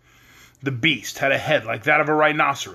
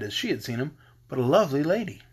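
usual speaking voice

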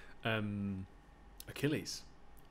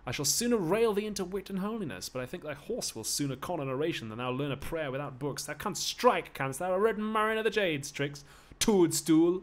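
high, raised-voice railing; theatrical quarrel, pitched up; angry railing; 6 semitones higher, 5 dB louder than usual. Acted. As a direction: rail back at your master. Slave rails at master.

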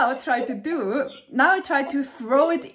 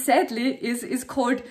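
normal talking voice

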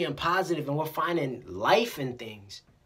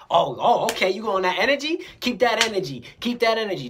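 loud, higher challenging comeback; mock confrontation; 4 semitones higher, 5 dB louder than usual. People answering cussing with cussing, mock combative. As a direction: fire back like you're accepting a fight.

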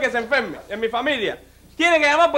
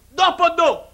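high, loud, emphatic question; executioner quizzing; 6 semitones higher, 5 dB louder, slower than usual. Acted. Executioner gives the condemned man his last sum, loud and high. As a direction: announce the final, easy sum. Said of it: "dice el verdugo".

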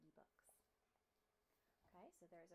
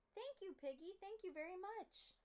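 grateful; 9 semitones higher, 4 dB louder than usual. Acted. Gerald thanks Piggy.

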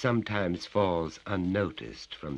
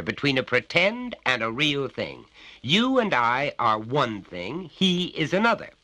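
higher, louder bullying father; menacing father; 9 semitones higher, 6 dB louder than usual. Acted. Father grips his son's neck, bullying and loud. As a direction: lean on the child with each claim.

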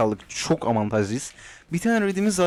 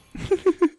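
high laugh. Real, not acted. Streamer laughing at his own misclick, high laugh. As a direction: burst of high-pitched laughter.

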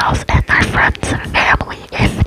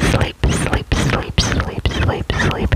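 soothing whisper; 3 dB quieter, slower than usual. Acted. Repeated soothing trigger words.

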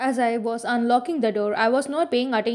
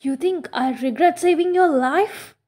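higher, louder, barely held-back anger; almost mad; 5 semitones higher, 4 dB louder than usual. A narrator's reading. Edward to Bella, stung by her accusation, rising anger. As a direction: let the anger slip through the question. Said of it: "he almost sounded mad".

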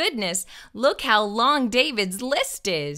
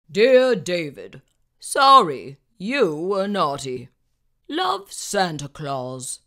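low, far-off Santa voice; 6 semitones lower, 4 dB louder than usual. Acted. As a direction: read it as Santa. Reading Santa's note.

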